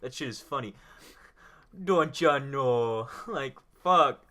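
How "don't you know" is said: slow, loud mock Midwestern accent; hammed-up Midwestern accent. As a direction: stretch it out like a Midwesterner. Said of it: "If I could do an Ohio accent".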